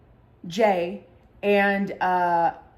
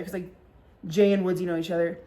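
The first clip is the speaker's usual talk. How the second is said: ordinary talk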